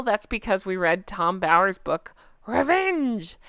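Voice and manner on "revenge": drawn-out, mock-dramatic "revenge"; running-gag revenge. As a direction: stretch the word out theatrically. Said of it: "we just started saying it like that".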